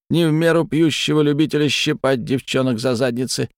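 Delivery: high, commanding spirit; eerie creature voice. Acted. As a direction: order him about like a mocking deity.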